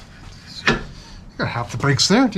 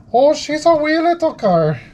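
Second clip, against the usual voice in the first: much higher, slow, drawn-out Irish-Minnesota lilt; Irish-Minnesota accent; 12 semitones higher, 4 dB louder, slower than usual. Pretend. Mechanic practicing Irish, hammed Irish-Minnesota accent. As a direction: stretch "Oh, she's a wee little car." in a hammed Irish-Minnesota accent. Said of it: "they sound like they're from Minnesota".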